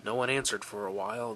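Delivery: ordinary delivery, nothing marked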